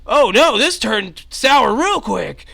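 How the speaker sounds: high, loud, slowed-down panic; alarmed cry. Acted. Ally seeing his friend fall, high loud alarm. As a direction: yelp it, then stretch out the dread.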